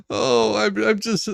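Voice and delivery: ordinary talk, nothing marked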